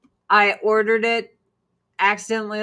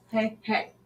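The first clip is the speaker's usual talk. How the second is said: ordinary talk, nothing marked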